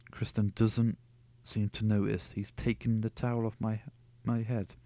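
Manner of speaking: usual speaking voice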